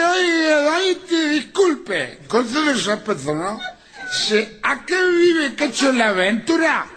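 put-on high, wobbly drunk apologizing; drunk apology. Drunk man apologizing to the woman, high wobbly voice. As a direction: plead politely while plastered.

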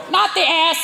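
high parrot squawk; parrot mimicry. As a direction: blurt it like a tattling parrot. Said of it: "the parrot will go".

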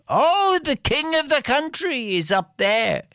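high, bright-eyed villager answering; high eager local. Acted. Villager telling the princes where the king is, high eager voice.

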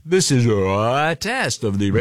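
slow warped tape voice; tape warble. Retold or imitated. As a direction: imitate a stretched tape recording. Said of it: "the tape had been kinda stretched".

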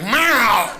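loud demanding meow; angry cat yell. Retold or imitated. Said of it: "they come in there like".

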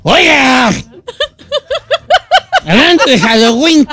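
borracho arrastrando la voz; town drunk. Pretend. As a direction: borrachito de pueblo. (voz de borracho)

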